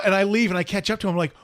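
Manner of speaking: normal talking voice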